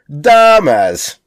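"dumbass" drawn out with a big first syllable; like "Da Bears". Shown as a demonstration. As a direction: stretch "dumbass" like a Chicago fan's "Da Bears". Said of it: "it's just a reference to".

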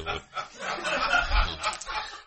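real burst of laughter. Real, not acted. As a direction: break off into laughing. Laughs at absurd spiritual advice.

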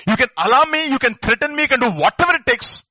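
loud, high, defiant declaration; defiant shout. Acted. Preacher defying his threateners, loud and high. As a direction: shout it out like you'll never give in.

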